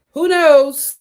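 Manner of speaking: loud playful who knows; exclaimed. Real, not acted. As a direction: toss it out with a shrug. Teasing shrug.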